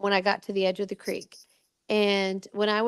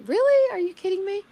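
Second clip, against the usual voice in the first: high, incredulous really, then settling; can't believe it; disbelief; 10 semitones higher than usual. Retold or imitated. Told she was on Good Morning America, astonished disbelief.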